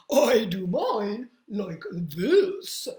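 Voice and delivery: big slow horse voice; slow loud horse voice. Acted. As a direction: boom it out like a horse. Horse answers.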